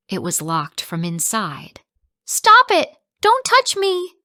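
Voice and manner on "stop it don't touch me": much higher, much louder terrified scream. Acted. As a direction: scream it at the attacker. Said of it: "my mother screamed".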